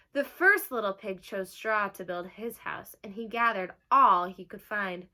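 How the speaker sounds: lively read-aloud for a class; bright picture-book reading; reading to children. Acted. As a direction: announce the title like storytime. Teacher reads the story aloud.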